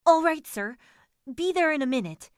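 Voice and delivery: high, loud servant's reply; calling back. A narrator's reading. Driver hurrying from his wine to his fare, calling back. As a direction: sing out eagerly to the boss.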